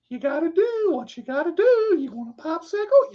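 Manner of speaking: high-pitched creepy geezer voice; creepy old man. Shown as a demonstration. Creepy old man offering a popsicle, high put-on voice. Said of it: "Creepy old man".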